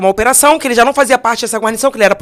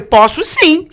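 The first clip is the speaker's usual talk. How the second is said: higher and louder, firm yes I can; defiant insistence; 6 semitones higher, 5 dB louder, much slower than usual. Retold or imitated. Woman defies the policeman, louder and higher. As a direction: push back harder than the guard.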